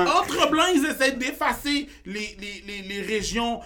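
very high, loud indignant rant; exasperated shouting. Real, not acted. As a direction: shout the point in real exasperation. Heated rant on diversity problem.

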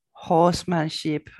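ordinary talk, nothing marked

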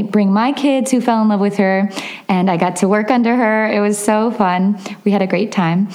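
usual speaking voice

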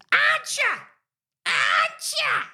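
piercing falsetto repetition; put-on voice. Shown as a demonstration. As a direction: echo the phrase in a very high squawk. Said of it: "my equivalent is".

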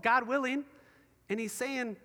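ordinary talk, nothing marked

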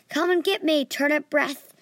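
shouted teasing dare; taunting yell. Acted. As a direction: yell it to draw the dragon. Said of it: "I yelled as I got closer".

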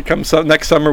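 normal talking voice

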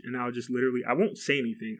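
ordinary talk, nothing marked

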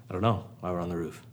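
low, quiet, fast befuddled friend; confused mumble. Acted. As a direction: mumble it low and quick, clueless. Acted confused friend on roof.

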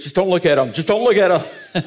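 retold insistent plea, rising in pitch and force; urgent mock warning. Wife warning husband off the staring passengers, mock-urgent. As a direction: play an urgent warning, half laughing by the end.

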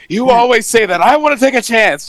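high, loud imitated protest; hammed-up, high-pitched insistence; mock exasperated outburst. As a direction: play a fed-up official arguing back. Acting out argument among threatened officials.

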